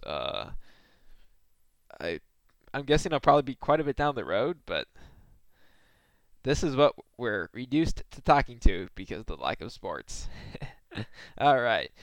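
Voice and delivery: ordinary talk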